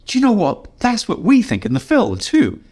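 high, bright imitation of Ron agreeing; quoting Ron. Ron telling the writer the film thinks the same, voiced high. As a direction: lift into Ron's delighted agreement.

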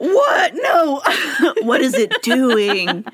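high shocked gasp; panicked Roman at a Roomba; startled panic. Retold or imitated. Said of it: "And they're like".

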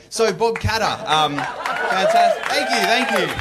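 milk it like a showman; mock fanfare. Fake applause-milking flourish.

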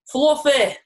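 high, loud Barnsley accent, drawn out; northern Barnsley accent. Shown as a demonstration. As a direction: put on a slow, loud northern English accent. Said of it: "with a northern accent".

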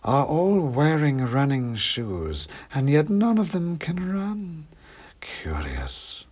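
slow, drawling big cat; amused predator toying with prey; snooty bored cat. A narrator's reading. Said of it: "came the voice from the trees".

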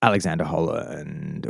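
deep voice. Acted. Deep voice self-introduction.

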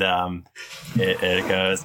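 ordinary talk, nothing marked

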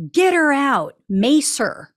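half-hearted shout of the quote; mild mock scream. Reading out what she screamed at the photographer, a mild mock scream. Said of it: "And Wire screamed".

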